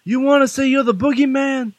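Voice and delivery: put-on high-pitched wrestling promo with a Boston accent; Boston-tinged wrestling promo. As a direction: cut a challenger's promo, Boston accent creeping in. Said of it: "I think you were using your Boston accent again".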